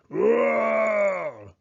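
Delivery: loud, high shouted bear roar. Acted. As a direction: let out a big roar. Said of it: "Old Bear roared".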